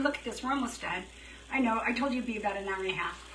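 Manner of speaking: ordinary talk, nothing marked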